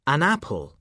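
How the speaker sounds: ordinary talk, nothing marked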